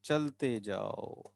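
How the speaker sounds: low, soft, very slow refrain; drawn-out coaxing. Real, not acted. Teacher lulling students onward, low soft drawn-out refrain. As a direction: let it drift out slowly and softly.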